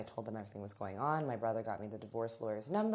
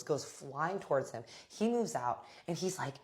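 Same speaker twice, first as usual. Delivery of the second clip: ordinary delivery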